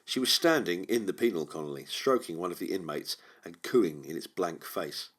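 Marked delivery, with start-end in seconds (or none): none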